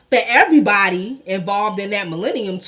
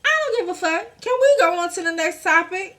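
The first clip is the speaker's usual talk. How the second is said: high, sassy brush-off; dismissive brush-off; 7 semitones higher, faster than usual. Pretend. Vlogger imagining her interview answer, dismissive and higher. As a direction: wave the question away. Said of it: "that's my response".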